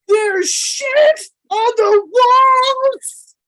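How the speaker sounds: high, slow, drawn-out mock wail; movie quote voice. Repeats movie line, higher.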